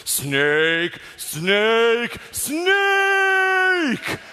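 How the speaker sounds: calls building into a high anguished yell; mock anguished calling. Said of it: "comms unit goes".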